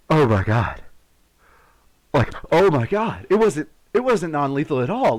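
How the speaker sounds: loud, aghast cries; retold horrified outburst; horrified exclaiming. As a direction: exclaim it in horror. Seeing blood everywhere.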